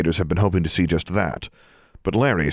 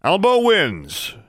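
high, drawn-out referee shout; long, high victory call; yelled announcement; 13 semitones higher, 4 dB louder, much slower than usual. A narrator's reading. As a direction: proclaim the winner slowly at a yell. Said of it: "yelled Shirtliff".

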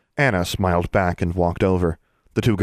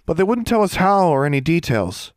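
put-on high-pitched child character, complaining; excitable youngster, voice held high; 10 semitones higher, 3 dB louder than usual. A narrator's reading. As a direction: pitch it way up like an aggrieved kid.

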